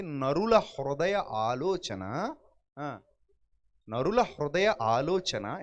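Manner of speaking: slow, drawn-out scripture quote; scripture recitation. Acted. Preacher quoting scripture to the congregation, slow and solemn. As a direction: intone the verse slowly.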